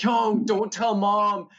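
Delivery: high, slow, drawn-out panic; panicked plea. Retold or imitated. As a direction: beg in a frantic rush of alarm. Caught, thinks it's brother.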